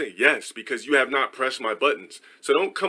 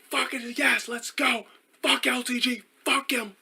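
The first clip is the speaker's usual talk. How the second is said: high, held-back gloating cheer; mock celebration, pitched way up without shouting; mock heckler; 12 semitones higher, 4 dB quieter, slower than usual. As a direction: imitate a taunting spectator in a high voice. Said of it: "kept fucking talking shit".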